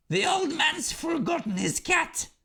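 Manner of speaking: put-on very high, gleeful child troll voice; high squeaky troll child. A narrator's reading. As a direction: squeal it like a delighted little troll. Troll child spots the hound.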